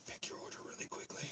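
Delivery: hushed whisper; whispered order. Retold or imitated. Waiter at the clicker dinner, whispering the order. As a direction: whisper it so the clicker won't hear. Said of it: "He's like".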